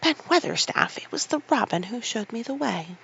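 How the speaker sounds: put-on girl calling breathlessly up the wall. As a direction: gasp it up to him. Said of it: "called out with a sort of gasp".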